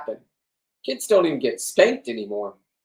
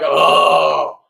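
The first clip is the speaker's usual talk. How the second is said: mock straining guy; 10 dB louder than usual. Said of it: "he's like".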